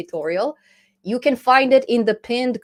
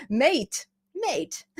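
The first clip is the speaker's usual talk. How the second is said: mock Australian; 7 semitones higher than usual. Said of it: "I think this is Australian".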